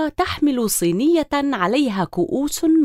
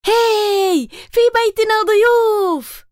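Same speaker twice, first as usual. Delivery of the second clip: high, loud and slowed cry of joy; excited cheer; 9 semitones higher, 5 dB louder, slower than usual. A narrator's reading. Little girl cheers that guests are coming, excited. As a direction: shout the news happily. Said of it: "هتفت تمارا".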